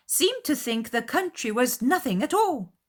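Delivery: loud, high jab; pointed mother. A narrator's reading. Mother jabbing at Darcy, loud and high. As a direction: needle the proud guest. Mrs. Bennet voiced.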